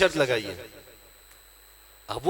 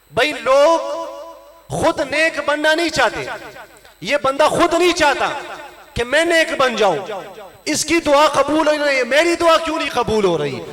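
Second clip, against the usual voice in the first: higher-pitched, emphatic sermon delivery; raised, ringing preacher's voice; impassioned preaching; 7 semitones higher than usual. Real, not acted. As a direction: drive the point home to a congregation. Preaches at full force.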